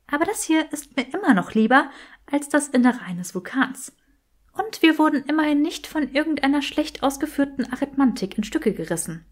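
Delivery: Granger. A narrator's reading. Granger tells Draco she prefers this to a volcano, lightly voiced.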